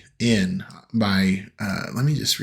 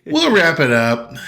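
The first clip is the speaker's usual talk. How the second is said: excited; 6 semitones higher, 4 dB louder than usual. Real, not acted. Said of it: "repeated wrap-up line".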